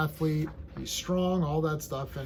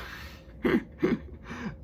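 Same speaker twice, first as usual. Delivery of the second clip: airy, high laugh with no voice; breathless laugh; 7 semitones higher, 4 dB quieter than usual. Real, not acted. Vlogger laughs at her own rant, breathy laugh. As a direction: let out a breathy giggle.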